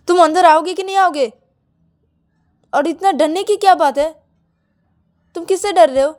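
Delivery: high, loud impatient challenge; angry demand. A narrator's reading. Bride demanding scared partner come inside, angry and loud. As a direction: raise your voice and demand an answer.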